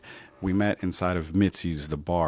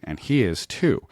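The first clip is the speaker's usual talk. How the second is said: ordinary talk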